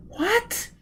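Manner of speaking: put-on high, questioning girl's voice; young woman's voice. A narrator's reading. Gisbella not hearing Foyle over the roar. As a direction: pitch up for the girl.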